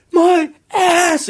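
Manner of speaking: high, agonized mock yelp; retold yell. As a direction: cry out as if burned, played for laughs. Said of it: "And I yell that".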